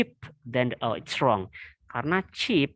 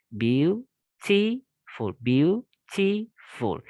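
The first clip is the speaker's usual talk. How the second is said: beautiful spoken slowly, each syllable drawn out; slow syllable demo; 4 semitones higher, much slower than usual. Shown as a demonstration. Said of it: "it has three syllables".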